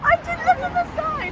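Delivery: high, slow silly voice owning up; mock sheepish confession. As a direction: squeak it like a guilty child. Mocking her own mistake.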